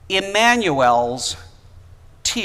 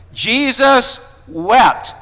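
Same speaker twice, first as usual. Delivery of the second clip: slow, loud and higher, calling out the answer; emphatic proclamation; 7 semitones higher, 7 dB louder, much slower than usual. Real, not acted. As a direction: belt the answer to the congregation. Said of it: "What is it? Jesus did what?".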